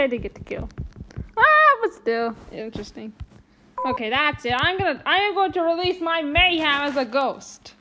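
usual speaking voice

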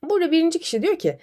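ordinary talk

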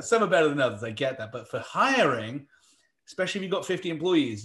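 ordinary talk, nothing marked

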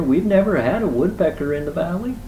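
high, lilting bear voice; acted pleased wonder; delighted. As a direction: marvel happily at the newcomer.

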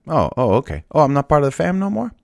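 high, loud and fast, hammed-up indignation; mock offended. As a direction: play the snubbed ally, sarcastic and quick. David voiced mocking rejected ally.

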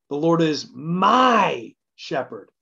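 slow, with a long stretched-out MY; MY swelling and drawn out; stress on MY. Shown as a demonstration. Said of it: "putting emphasis on different words".

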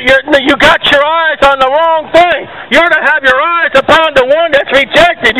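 normal talking voice

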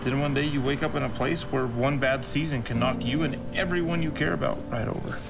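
ordinary talk, nothing marked

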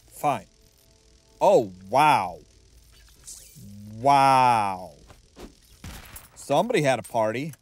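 ordinary talk

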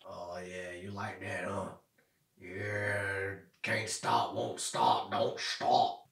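very soft, low pet talk; hushed, low cat-talk; murmuring to a cat. Real, not acted. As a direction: murmur low and quietly while petting. Murmuring while petting the cat.

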